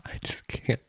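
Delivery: voiceless through laughter; can't breathe from laughing; whispered laughing. Real, not acted.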